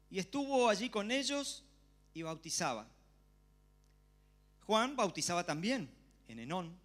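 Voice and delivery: loud, solemn public reading; scripture reading. Acted. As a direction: proclaim the passage to the congregation. Reads scripture to congregation. (lectura bíblica solemne y en voz alta)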